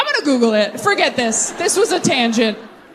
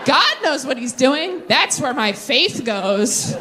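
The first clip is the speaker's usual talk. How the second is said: put-on naive kid; 5 semitones higher, slower than usual. Naive kid self, airy.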